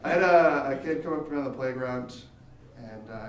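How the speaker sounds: usual speaking voice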